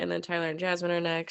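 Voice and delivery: ordinary talk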